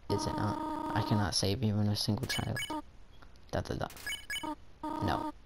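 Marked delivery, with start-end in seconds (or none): 0.1-0.6 high, soft sad line; character voice. Acted. 5.0-5.3 high, soft sad line; character voice. Acted. Reads character lines high.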